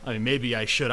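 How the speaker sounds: normal talking voice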